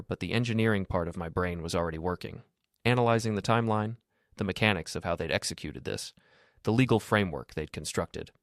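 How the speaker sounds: usual speaking voice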